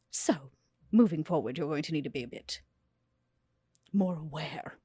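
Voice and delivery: usual speaking voice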